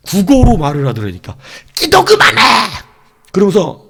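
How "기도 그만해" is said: very high, loud imitated scream; screamed command. Preacher replaying the voice that ordered him to stop praying, screamed command. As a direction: burst into a piercing shout. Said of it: "이렇게 소리를 지르는 거예요".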